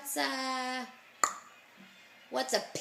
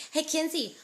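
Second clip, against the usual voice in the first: ordinary talk, nothing marked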